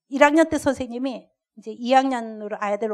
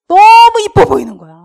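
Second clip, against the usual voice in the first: overjoyed; 8 semitones higher, 19 dB louder than usual. Real, not acted. Sudden delight at the child.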